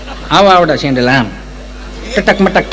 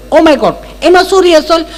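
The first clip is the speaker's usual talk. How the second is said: a higher, mincing educated lady; prim city lady; 9 semitones higher than usual. Retold or imitated. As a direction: mimic a fussy city woman picking each word. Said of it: "Gujarati ચીપી ચીપીને બોલે". (શહેરી બેનની ચીપી ચીપીને બોલતી નકલ)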